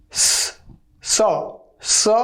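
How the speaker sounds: स said alone, starting as a drawn hiss; isolated s sound. Shown as a demonstration. Teacher showing students what comes first, isolated स sound. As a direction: isolate स in separate, spaced repetitions. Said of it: "पहले स पढ़ना है".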